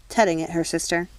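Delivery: plain reading voice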